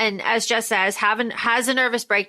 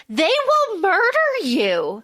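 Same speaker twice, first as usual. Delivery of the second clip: slow, higher worried warning; nervous warning; 7 semitones higher, slower than usual. Retold or imitated. Nervous Tommel warning Siona, slow higher worried voice. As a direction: warn her slowly, a little higher. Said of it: "He's like".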